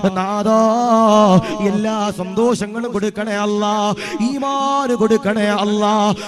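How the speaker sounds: loud, slow, pleading prayer chant; rising loud supplication; fervent dua. Acted. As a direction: beg God's blessing for the expatriate brother.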